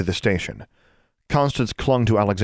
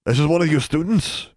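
a higher, put-on voice for the wizard; wizard's voice; 9 semitones higher than usual. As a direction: voice the strange wizard, a bit higher. Male wizard voiced by narrator.

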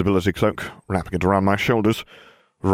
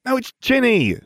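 character voice. A narrator's reading. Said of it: "she said".

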